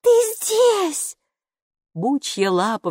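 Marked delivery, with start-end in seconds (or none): 0.0-1.2 put-on high, bright little girl. A narrator's reading. Girl explaining herself to the neighbour, childlike. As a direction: play the small girl explaining.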